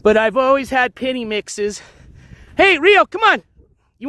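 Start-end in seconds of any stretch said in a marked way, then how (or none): 2.6-3.4 calling the dog. Real, not acted.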